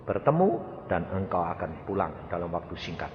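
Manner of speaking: solemn praying voice, steady; prayer. Acted. Preacher praying over a patient. (doa pendeta yang khidmat)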